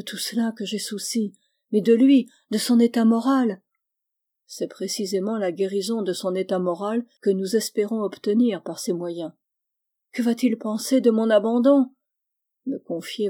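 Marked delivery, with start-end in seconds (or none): 1.7-3.6 loud anguished insistence; anguished worry. Acted. Charlotte voices her worry. 10.1-11.9 loud distressed question; anguished question. Acted. Charlotte fears his judgement.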